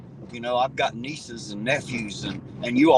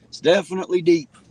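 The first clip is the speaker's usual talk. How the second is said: higher and louder, leaning on deep; deep said high and loud with a falling end; emphatic repeat; 6 semitones higher, 7 dB louder than usual. Shown as a demonstration. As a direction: say it again like you mean it.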